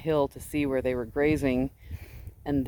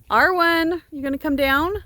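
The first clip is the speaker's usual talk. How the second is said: high, loud call across the field; 12 semitones higher, 6 dB louder than usual. Real, not acted. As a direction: call her down the hill. Calling a sheep from afar.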